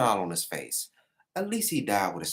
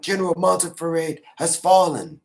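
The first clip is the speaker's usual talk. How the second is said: loud, high battlefield cry; announcing a death; 9 semitones higher, 6 dB louder than usual. Retold or imitated. Soldiers crying out that their general has fallen.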